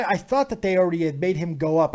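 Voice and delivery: normal talking voice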